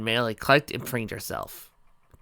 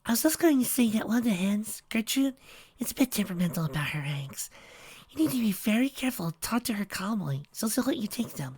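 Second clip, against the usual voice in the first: high, gentle pony voice; timid Fluttershy; 11 semitones higher, 3 dB quieter than usual. A narrator's reading. Fluttershy explaining chicks to Trixie, in a gentle high voice. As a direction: reassure softly like a shy pony.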